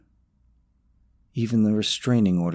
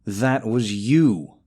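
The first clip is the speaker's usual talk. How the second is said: slow, pointed, louder retort; deadpan accusation; 2 semitones higher, 5 dB louder, much slower than usual. Acted. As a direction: lay the blame slowly. Flat accusation at rival.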